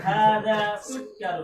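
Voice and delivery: sukkarun with a held kk, loud with a laugh; doubled k, laughing. Shown as a demonstration. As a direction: repeat sukkarun with the long kk. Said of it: "Coba diulangi lagi".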